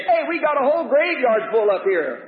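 high-pitched heckler calling out a dare; heckling challenge. Acted. Priest daring the prophet to raise the dead, heckling. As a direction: taunt him as a heckler in the crowd.

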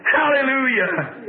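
high, long-drawn Hallelujah; real preacher's shout of praise; exultant cry. Real, not acted.

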